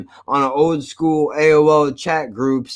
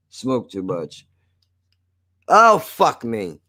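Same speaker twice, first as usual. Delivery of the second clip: ordinary talk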